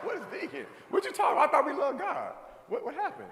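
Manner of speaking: high, loud incredulous outburst; mock panic. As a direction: yelp it out in comic disbelief. Retold panicked reaction to vegan.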